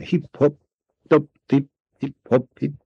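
high, light little hops; high-pitched, staccato hopping rhythm; hopping sounds. A narrator's reading. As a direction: pip each syllable high, like tiny leaps. Narrator imitates tiny hopping movements.

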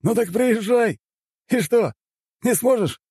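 very high-pitched jeering dare; high, agitated provocation; taunting challenge. A narrator's reading. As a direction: goad him in a high character voice. Juan taunts the emperor in quarrel.

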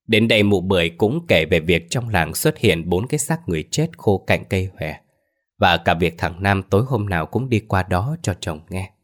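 plain reading voice

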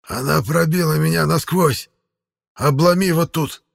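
high, strained cry; voice distorted by pain. A narrator's reading. Wounded man instructs helper on arrow, agonised strain. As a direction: urge the helper on through agony. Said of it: "Голос Эриля от боли исказился до неузнаваемости".

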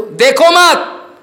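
high, loud, drawn-out command; shouted prohibition. Real, not acted. Guru forbidding disciples to look, high drawn-out shout. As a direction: cry it out as a slow warning.

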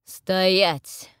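loud halt order, drawn out; booming command. A narrator's reading. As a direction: boom the order from up above. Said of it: "откуда-то сверху гулко звучит голос".

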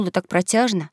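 usual speaking voice

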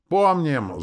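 high, loud cry falling away; crowd shout. A narrator's reading. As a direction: answer as an eager crowd. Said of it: "закричал народ". (громкий крик народа)

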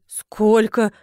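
loud, shocked question; gasped shock. A narrator's reading. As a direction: gasp it in disbelief. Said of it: "ахнула Тася". (ошеломлённо ахает)

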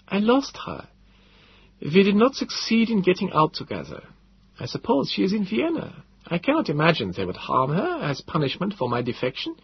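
put-on high old man's voice, subdued and uncertain; Gödel, forlorn. A narrator's reading. Gödel fearing for his wife in Vienna, high, subdued and unsure.

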